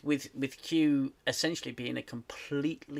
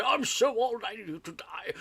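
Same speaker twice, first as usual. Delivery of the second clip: Picard; 6 semitones higher than usual. Retold or imitated. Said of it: "No more moany Picard saying".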